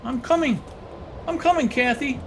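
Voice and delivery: very high, desperate cry; high-pitched, urgent answering call; frantic calling out. Acted. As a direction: call ahead to a lost love. Running out after the ghost.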